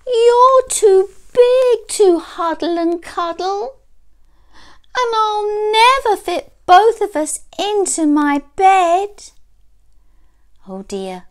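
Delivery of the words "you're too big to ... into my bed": high, loud wail of complaint; dismayed little boy. A narrator's reading. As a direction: lament it like an upset child. Boy laments his oversized teddy.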